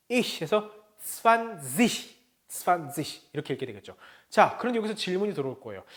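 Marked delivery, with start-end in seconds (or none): 0.1-0.4 ich ending in a soft, sustained "sh"-like sound. Shown as a demonstration. Teacher explains the -ig ending, ich with soft ch. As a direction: draw out the final soft ch of ich. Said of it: "단어 끝에 이 개가 붙어 있으면 ich".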